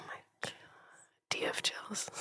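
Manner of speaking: hushed, whispering amazement; awed whisper. Real, not acted. Asking her co-host about chills in a hushed whisper. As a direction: whisper it, wide-eyed.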